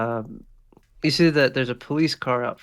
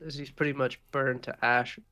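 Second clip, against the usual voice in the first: usual speaking voice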